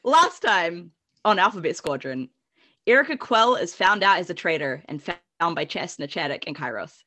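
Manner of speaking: measured storyteller recap voice; podcast recap read. Acted. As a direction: read the recap like a show intro. Reads episode recap.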